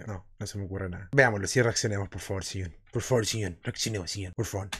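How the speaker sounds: half-joking plea under the breath; mumbled pleading. Acted. Mutters, begging the video to start.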